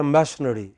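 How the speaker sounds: machinery as MASH-uh-nuh-ree; southern mispronunciation. Shown as a demonstration. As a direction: stress MASH, hurry the rest of machinery. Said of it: "don't pronounce it properly".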